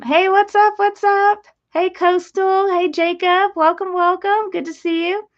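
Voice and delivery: high, loud, bubbly hello; excited greeting. Real, not acted. Streamer greets viewers joining, excited and high.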